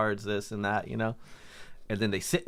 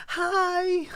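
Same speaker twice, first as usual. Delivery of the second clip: much higher, sing-song fake innocent hi; 4 dB louder than usual. Storyteller imitating the fighting couple greeting him, much higher fake-sweet hi. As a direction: greet them as if nothing happened. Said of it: "I shit you not".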